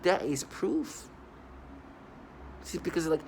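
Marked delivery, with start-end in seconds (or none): 0.0-1.1 slow, drawn-out mock pronouncement; slow mocking quote. Mocking the people who call it proof, slow and smug. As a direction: drag it out like a smug verdict. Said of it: "And they're like".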